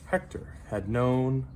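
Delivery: ordinary delivery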